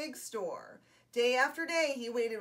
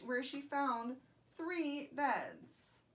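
ordinary delivery, nothing marked

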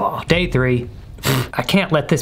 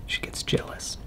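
whispering; 13 dB quieter than usual. Real, not acted. Secret aside while hiding in game.

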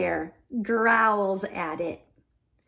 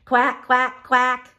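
louder chorus of quacks; ducklings quacking; 3 semitones higher, 6 dB louder, slower than usual. A narrator's reading. Ducklings answering the moon with loud quacks. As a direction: quack it out as a row of little ducklings. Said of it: "say the ducklings".

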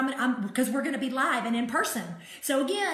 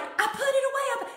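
wife's voice; 11 semitones higher than usual. Retold or imitated. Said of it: "And she's like".

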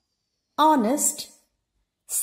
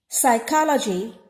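usual speaking voice

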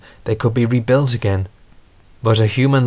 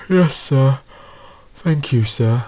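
sleepy, yawn-laden reply; tired engine just after yawning; 3 semitones higher, slower than usual. A narrator's reading. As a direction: answer through the tail of a yawn. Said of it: "Montague yawned".

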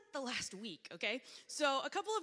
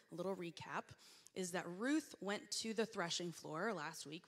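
ordinary talk

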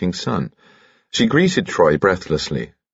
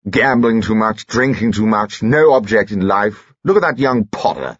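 indignant, slightly raised pompous grumble; pompous disapproval; 4 semitones higher than usual. Acted. Husband complaining to wife about modern youth, pompously indignant. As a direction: lay down the law with mild indignation.

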